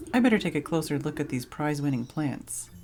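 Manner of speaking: ordinary talk, nothing marked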